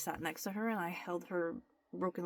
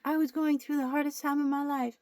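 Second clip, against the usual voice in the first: higher and louder overdone drama; mock-tragic, pitched up and loud; mock dramatic confession; 8 semitones higher, 8 dB louder than usual. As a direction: ham up a tearful influencer confession. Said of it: "they're like".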